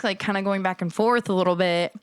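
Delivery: ordinary talk, nothing marked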